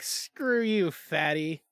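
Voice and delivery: rude internet voice. Retold or imitated. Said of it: "my internet was like".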